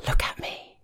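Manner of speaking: soft unvoiced whisper. A narrator's reading. Lover at the brink asking him to look, whispered. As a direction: breathe it right against his lips. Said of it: "and whispers".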